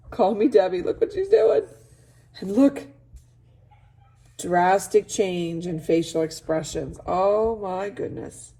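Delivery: usual speaking voice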